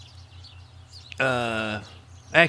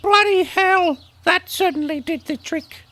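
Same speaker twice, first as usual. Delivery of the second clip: very high and louder, cheery British critter; high British creature voice; 4 dB louder than usual. Acted. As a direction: squeak the praise like a tiny British sidekick.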